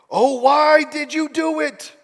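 high, drawn-out theatrical wail; hammed-up dismayed moan; groaning dismay. A narrator's reading. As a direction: groan the question slowly. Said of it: "they groaned".

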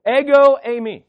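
Ego punched loud and high, eimi dropping back; emphatic Greek phrase. Shown as a demonstration. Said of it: "Let's all say that together".

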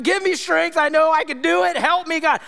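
put-on high, pleading child's prayer; imitated cry praying. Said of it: "she was cry praying".

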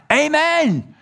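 loud, high-pitched Amen; crowd's amen. Acted. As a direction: call it out like a huge congregation. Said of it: "perhaps all the five, ten, fifteen thousand".

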